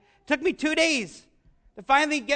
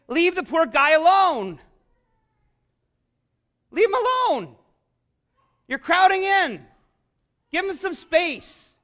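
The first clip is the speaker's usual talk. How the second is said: disciples shooing crowd; 4 semitones higher, 3 dB louder than usual. Retold or imitated. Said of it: "They're basically saying".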